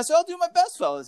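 put-on high eager boy voice; chirpy and eager; eager kid voice. Voicing eager character.

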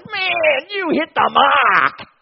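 loud, high and slowed, almost crying; lovestruck cry. Retold or imitated. Newlyweds first seeing each other, loud slowed lovestruck cry. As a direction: gush it with full heart. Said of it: "when we fell in love, it was".

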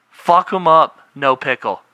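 high, loud mock outrage; angry mock shout. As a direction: yell it like a furious order. Mock-quoting pickle-happy workers.

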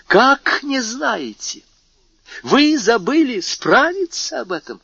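the king berates the police minister, incredulous; incredulous rebuke. Acted. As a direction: demand it in disbelief. King scolds minister for ignorance.

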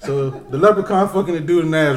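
ordinary delivery

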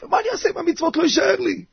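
high, fast, helpless complaint; plaintive protest. Acted. Husband worried no matzah will be left, high hurried protest.

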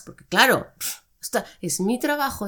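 usual speaking voice

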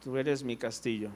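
"Tú eres mi castillo" dicho más bajo y suave, sin nada de emoción; recítalo como piedra, sin sentir nada; sin expresión. Shown as a demonstration. (el hermano que canta sin expresar nada)

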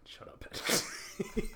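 slow laughing shut-up; teasing laughing scold. Real, not acted. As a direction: laugh it out at a friend.

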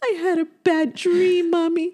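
put-on high, slow tearful little girl; crying child. Seven-year-old telling her mother, imitated crying. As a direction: whine it like an upset child. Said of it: "She's crying".

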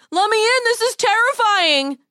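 high, frightened call for help; a scared call through the window. Acted. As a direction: cry out to be let in. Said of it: "he called out".

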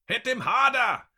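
high, loud urging yell; fierce high-pitched shout. A narrator's reading. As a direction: shout from the onlookers, egging him on. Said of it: "shouts one of the men watching".

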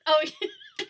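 high burst of laughter; laughing. Real, not acted. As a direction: break up laughing. Realizes rhyme, bursts out laughing.

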